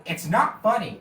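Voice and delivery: high, loud pouty complaint; pouty cartoon whine; whiny protest. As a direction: sulk loudly at the laughers. Rigby laughed at.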